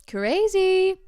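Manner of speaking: high, loud burst of disbelief; amazed exclamation. Real, not acted. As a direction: call it out, stretched and high. Exclaims at the age lie.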